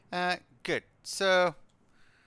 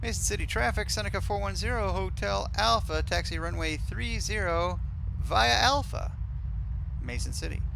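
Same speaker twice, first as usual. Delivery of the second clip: higher-pitched, procedural radio callout; pilot radio call; 8 semitones higher, 4 dB louder than usual. Real, not acted. Pilot to Mason City traffic, announcing taxi, higher radio-call voice.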